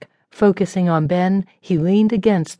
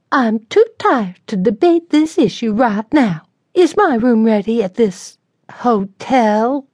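higher, weary and demanding; haughty tired lady; 5 semitones higher, 3 dB louder than usual. A narrator's reading. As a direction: demand the room, fed up. Sarah voiced, weary demand.

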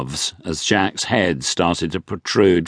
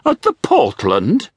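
slow, high aghast dowager; a scandalised grand dame; wealthy widow; 8 semitones higher, 3 dB louder than usual. A narrator's reading.

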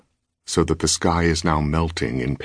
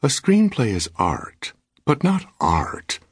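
slow, higher, coolly weighing "not art"; wife calmly lecturing her husband, slow, pitched up; calm reply; 9 semitones higher, slower than usual. A narrator's reading. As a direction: answer with unruffled, slow superiority. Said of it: "Her completely calm reply".

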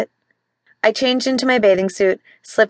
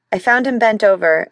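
ordinary delivery

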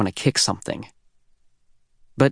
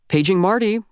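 higher, a called-out summons across the stage; calling out; 8 semitones higher, 3 dB louder than usual. Acted. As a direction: call him from the stage, a bit louder. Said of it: "Ms. Albright calls from the stage".

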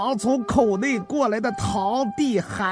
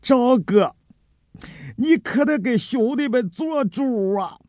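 tearful whine; 12 semitones higher than usual. Acted. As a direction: wail to the boss for help, hammed-up. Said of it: "孙凯开口就是一副哭腔". (带着哭腔诉苦求助)

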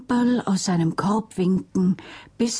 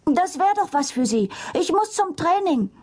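put-on breathless boy; 10 semitones higher than usual. A narrator's reading. Hannes rushing, breathless boy.

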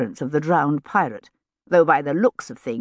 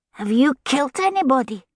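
put-on high, curious small boy's voice; interested little boy; 8 semitones higher than usual. A narrator's reading. Little boy asks the soldier about killing, high and interested. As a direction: ask it as an eager child. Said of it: "the boy inquired with interest".

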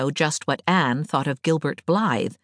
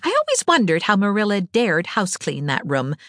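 usual speaking voice

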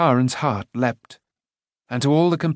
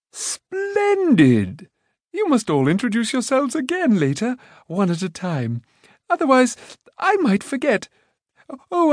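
much higher, cheerful rambling voice; scatterbrained Prince Rune; 7 semitones higher than usual. A narrator's reading. Rune to the companions, proud and breezy. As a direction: chatter on brightly.

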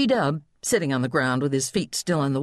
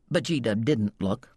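usual speaking voice

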